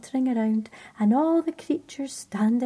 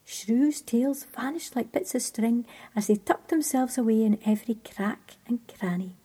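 usual speaking voice

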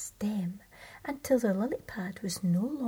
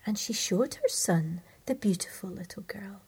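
ordinary delivery

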